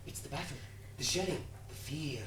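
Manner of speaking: soft, low, broken into short phrases; hushed consoling. Acted. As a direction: soothe a shaken comrade quietly. Soothing his shaken comrade.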